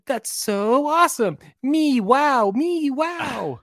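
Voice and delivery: high, drawn-out singsong cartoon voice; cartoon cats. Cartoon cats celebrating a success, high singsong cheer. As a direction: imitate cheering cartoon cats, stretching each word. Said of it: "they're like".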